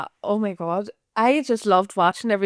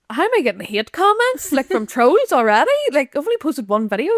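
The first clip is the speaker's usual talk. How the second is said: much higher incredulous question; disbelief; 6 semitones higher than usual. Retold or imitated. Retelling being trolled after one video, high incredulous. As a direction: sound baffled and outraged.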